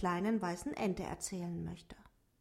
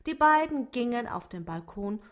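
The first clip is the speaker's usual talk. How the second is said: ordinary delivery, nothing marked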